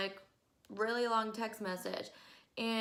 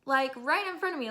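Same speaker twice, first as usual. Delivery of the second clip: ordinary talk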